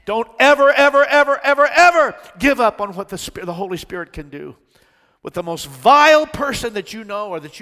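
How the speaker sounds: loud, high, soaring preacher's cry; full-force preaching. Real, not acted. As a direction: preach it to the rafters, building fervor.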